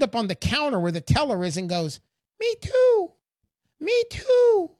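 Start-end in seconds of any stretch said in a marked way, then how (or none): 2.4-4.7 much higher piped little voice; tiny Uncle Sam voice. Retold or imitated. Uncle Sam butting in at the bank counter, tiny high voice. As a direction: squeak it up from down by the shins. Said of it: "this little voice from down by your shins".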